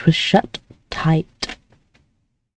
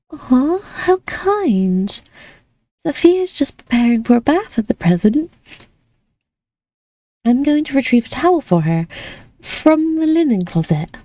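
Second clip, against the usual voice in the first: put-on light, higher girlish voice; young lady voice; 6 semitones higher than usual. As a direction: play the sweet, naive young lady. Amelie's voice continues.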